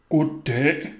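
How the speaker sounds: put-on child putting on a deep man's voice. A narrator's reading. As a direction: greet her in a put-on deep voice. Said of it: "sagde jeg med en dyb stemme".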